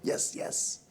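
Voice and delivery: whispered, imitated director's approval. Imitated director eagerly agrees in whisper.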